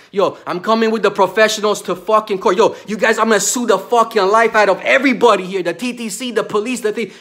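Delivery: real rage, vowing to sue them all; furious shout. Real, not acted. Man threatening to sue transit and police, furious shout.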